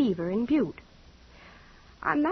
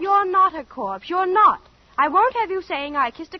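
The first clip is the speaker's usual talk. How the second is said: loud, high insistence on NOT; indignant protest; heated denial; 6 semitones higher, 6 dB louder than usual. Acted. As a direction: push back hard and fast. Girl protests back heatedly.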